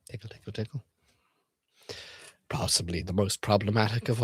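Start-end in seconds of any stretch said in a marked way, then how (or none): 0.1-0.8 "Tickle, tickle, tickle" whispered breathily; creepy whisper. Acted.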